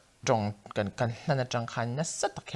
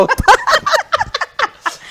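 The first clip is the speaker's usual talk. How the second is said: long, very loud high-pitched laugh; high laughter; 14 dB louder than usual. Real, not acted. Joking with the co-host, shrieking with laughter. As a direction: crack up completely, high and loud. Breaks up after own remark.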